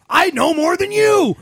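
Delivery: mock the know-it-all, shouting. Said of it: "that's always your argument".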